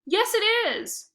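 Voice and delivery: put-on high, loud childish whine; whining. A narrator's reading. Shelby whines back at Lexi, high and childish. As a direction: whine like a sulky girl. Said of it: "Shelby whined".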